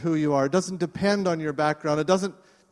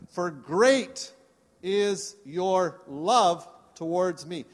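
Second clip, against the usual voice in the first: scripture reading; 4 dB louder than usual. Acted. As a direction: lift each phrase to the room. Pastor reads Psalm aloud.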